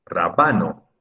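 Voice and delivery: "Rábano" said as raBAno; wrong middle stress. Shown as a demonstration. Teacher testing a pupil with a wrong stress, "Rábano" stressed on BA. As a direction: put the weight on BA in "Rábano". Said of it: "sería grave o llana".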